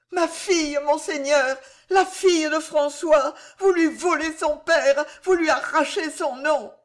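high, desperate pleading wife; begging young wife. Acted. Jeanne begging the constable to spare François: high desperate plea. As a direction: throw yourself on his mercy.

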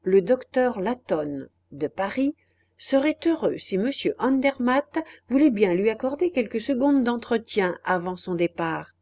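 usual speaking voice

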